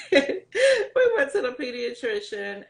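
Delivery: real laugh spilling into a slow line. Real, not acted. Teen mother recalling the doctor visit, laughing. Laughs as she tells her story.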